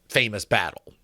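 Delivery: plain reading voice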